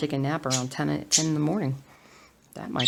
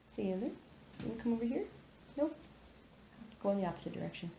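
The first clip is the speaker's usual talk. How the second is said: soft coaxing; 5 semitones higher, 12 dB quieter, faster than usual. Real, not acted. Coaxing the dog softly.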